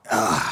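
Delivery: short sharp gasp of fright; startled gasp. Acted. Startled by something in the dark hut, a sharp gasp. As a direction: catch your breath at a sudden noise.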